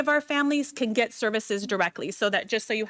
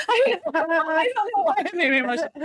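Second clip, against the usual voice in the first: embarrassed laugh, pitched higher; laughing through emotion; 8 semitones higher, faster than usual. Real, not acted. Handing certificates to her team, laughing at her own tears. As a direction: laugh it off while getting choked up.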